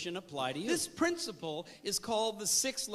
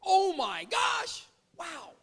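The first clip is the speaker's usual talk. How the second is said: high, slow gasp of amazement; be blown away; shouted disbelief, way up high; astonished; 9 semitones higher, slower than usual. Shown as a demonstration. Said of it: "The word we use up here is unbelievable".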